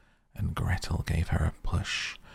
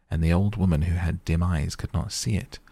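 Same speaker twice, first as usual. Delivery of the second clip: ordinary delivery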